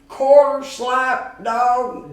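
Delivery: cook calling an order. Retold or imitated. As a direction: call the order like a short-order cook.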